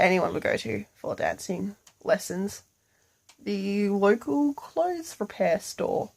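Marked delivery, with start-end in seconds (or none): none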